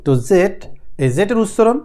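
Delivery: usual speaking voice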